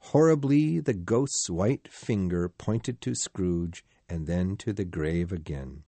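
ordinary delivery, nothing marked

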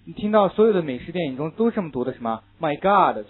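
American film exclamation, loud; American oh my God. Retold or imitated.

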